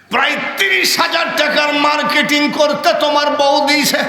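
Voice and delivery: high, forceful preacher's cry; ringing high exclamation; loud declaiming. Acted. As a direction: proclaim it high-pitched to the whole crowd. Preacher cries out the sum.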